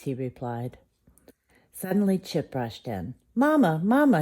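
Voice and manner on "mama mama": put-on high, louder child call for mama; a child's excited call. A narrator's reading. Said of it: "he called".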